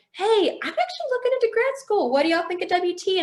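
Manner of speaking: high-pitched friendly approach; eager inquiry. Retold or imitated. Retold eager approach to instructors.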